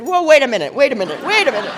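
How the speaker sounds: half-joking shout to stop her; startled protest. Retold or imitated. Retold reply to mother's joke.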